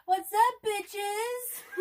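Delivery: put-on high, slow valley girl drawl; bubbly sorority girl greeting.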